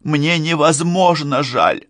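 high, slow, anguished regret; tearful apology. A narrator's reading. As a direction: apologise near tears, drawing the words out. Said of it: "тоже плача".

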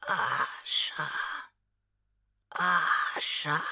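whispered, distant calling; faint moan-like call. Acted. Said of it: "Не то стон, не то речь".

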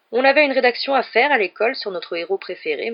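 high, loud schoolboy recital; eager child reciting a compliment; fawning boy. A narrator's reading. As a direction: lay on rehearsed flattery.

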